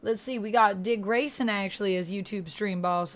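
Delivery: usual speaking voice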